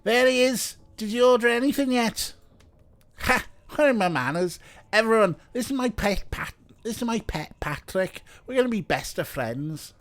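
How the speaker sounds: lively, lightly faked Scouse; bad Scouse accent. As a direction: introduce a pal in hammed-up bad Scouse. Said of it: "I'm doing a bad Scouse".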